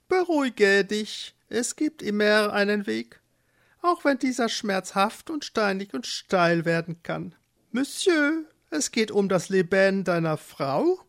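hohe, beruhigende Flamingostimme; tröstender Flamingo. Acted. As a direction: den verzagten Eduard höher und leicht französelnd aufmuntern. (Flamingos ermutigen Eduard, hoch und tröstend)

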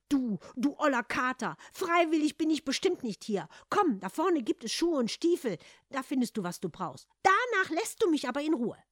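loud, high, exasperated grumbling; annoyed retort. A narrator's reading. Reluctant Hans answers the bossy cat, annoyed retort.

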